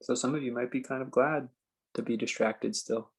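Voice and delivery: slow, spacious meditation guide with long pauses; calm phrases left hanging in silence; guided meditation. Acted. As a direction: ease them into stillness, unhurried.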